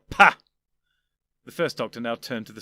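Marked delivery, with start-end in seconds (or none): 0.1-0.4 loud scoffing pah; dismissive pah. Acted. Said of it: "dismissing this admonishment".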